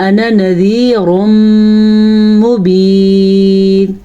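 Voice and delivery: slow, measured tajweed recitation; Quranic recitation. Shown as a demonstration. Teacher reciting a verse for students, slow measured tajweed. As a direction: recite with long, sustained vowels.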